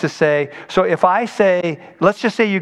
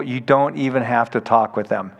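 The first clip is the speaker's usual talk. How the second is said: ordinary delivery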